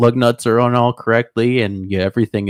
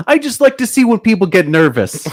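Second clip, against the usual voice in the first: much higher, giddy killer impression; high put-on voice, breaking into laughter; mock hilarity; 11 semitones higher, 4 dB louder than usual. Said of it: "he just starts laughing hilarious".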